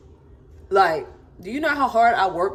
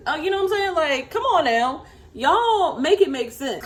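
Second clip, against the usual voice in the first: exasperated; 9 semitones higher than usual. Real, not acted. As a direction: drag it out in disbelief. Exasperated at commenters.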